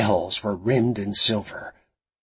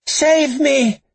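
very high, loud cry for rescue; desperate plea; 7 dB louder than usual. A narrator's reading. Drugged captive begs Frankie through the camera, desperate high cry. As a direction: cry out like a terrified child. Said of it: "with the whimper of a child".

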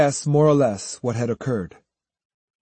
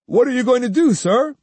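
put-on keen young follower's question, pitched up; eager; 11 semitones higher, 4 dB louder than usual. Judo asking his master what happens next, eagerly. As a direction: ask the master with bright-eyed eagerness, higher. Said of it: "Judo asked eagerly".

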